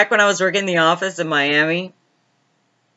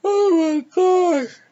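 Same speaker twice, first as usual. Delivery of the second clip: breathless laughing gasp; 13 semitones higher, much slower than usual. Real, not acted. Laughing at a joke.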